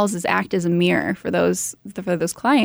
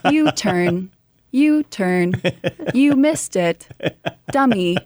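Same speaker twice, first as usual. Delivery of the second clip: snarky satnav voice, higher at the end; GPS navigation voice; 5 semitones higher, slower than usual. Retold or imitated. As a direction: navigation system scolding a driver. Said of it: "a G P S navigation voice".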